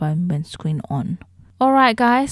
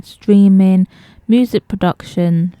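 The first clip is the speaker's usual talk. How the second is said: ordinary talk, nothing marked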